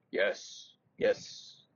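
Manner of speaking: soft, unvoiced old-man rasp; the old knight agrees in a whisper; breathy whisper. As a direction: mutter it in a dry whisper.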